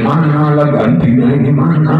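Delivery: loud hammed-up cry; mock loud wail. Widow crying out while peeking through her fingers, loudly. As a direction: cry out for a new husband.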